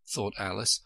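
plain reading voice

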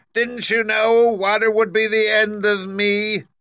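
high, loud, slow wail of a dying witch; wailing, desperate witch. Acted. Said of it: "in a wailing, desperate voice".

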